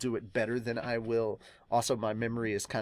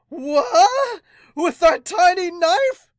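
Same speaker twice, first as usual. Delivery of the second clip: much higher, much louder shocked yell; shocked shout; 12 dB louder, much slower than usual. Acted. Manga character shouting in shock at a tiny knife. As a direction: yell it in total disbelief.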